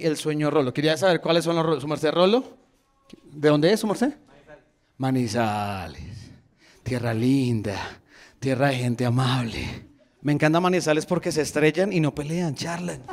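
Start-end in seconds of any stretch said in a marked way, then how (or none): 5.0-9.9 low, slow, softer praise; elogio pausado. Real, not acted. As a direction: savour the town's name slowly and low. Savours the spectator's home town. (elogio lento, grave y suave)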